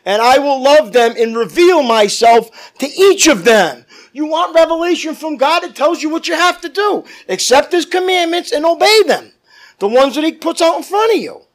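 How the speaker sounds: loud, driving pulpit preaching; preaching at full force. Acted. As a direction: preach it out to the congregation.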